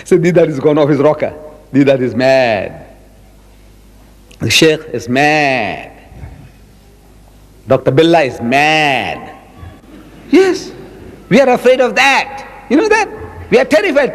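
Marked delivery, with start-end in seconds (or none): 0.2-9.4 mocking gossips. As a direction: drag it out like scoffing neighbours. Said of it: "What will people say?".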